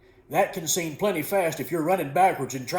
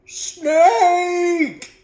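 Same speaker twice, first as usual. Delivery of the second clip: very high, loud drawn-out yell; panicked alarm cry, high and loud; frightened yell; 11 dB louder than usual. A narrator's reading. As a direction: yell it long, pitched way up high. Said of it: "Wes yelled".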